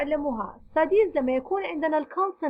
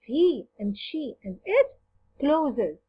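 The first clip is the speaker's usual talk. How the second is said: "he and she and it" drawn out, then "closes" with the final S marked; stress on the S ending; much slower than usual. Shown as a demonstration. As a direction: lean on the S of "closes" so learners hear it. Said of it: "إذن نضيف ال S". (تشديد على حرف S في closes)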